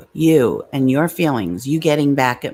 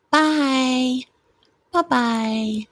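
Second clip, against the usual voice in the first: drawn-out, higher sing-out bye; dismissive goodbye; 6 semitones higher, much slower than usual. Real, not acted. Streamer seeing off a viewer, dismissive. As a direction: wave them off with long byes.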